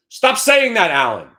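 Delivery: loud, high yell at Alan; shouted protest. Real, not acted. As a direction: cut him off with a loud yell. Yelling at a co-host.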